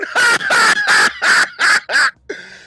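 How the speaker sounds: loud, high staccato cackle; hysterical mock laughter. Schoolboy pointing at the screen, hammed-up loud cackling. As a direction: blast out exaggerated shrieking ha-ha-has. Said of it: "laughing my ass off".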